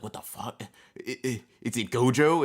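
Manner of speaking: mock startled, stammering disbelief. Streamer imagining Yuta sensing something, startled disbelief. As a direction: act out a character's baffled shock. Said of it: "He'd be like".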